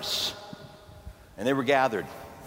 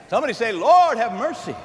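call to the congregation; 4 semitones higher, 6 dB louder than usual. Acted.

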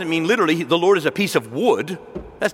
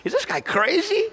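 incredulous; 13 semitones higher than usual. Retold or imitated. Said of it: "and they said".

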